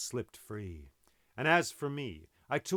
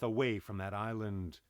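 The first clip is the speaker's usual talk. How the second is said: ordinary delivery, nothing marked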